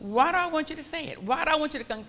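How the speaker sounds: ordinary delivery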